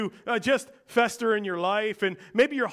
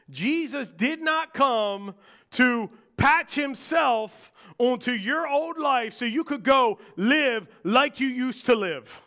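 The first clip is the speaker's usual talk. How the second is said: slow, loud, rising to a shout; preaching at full force; 3 semitones higher, 3 dB louder than usual. Real, not acted. Preacher urging his church about transformation, loud and slow. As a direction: drive each word home to the congregation.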